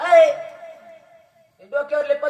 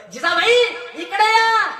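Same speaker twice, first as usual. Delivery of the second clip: higher and louder summons; calling his wife; 7 semitones higher, 3 dB louder than usual. Retold or imitated. Tukoba calling his wife to come, loud. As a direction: call her over from a distance. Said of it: "स्वतःच्या बायकोला बोलवलं".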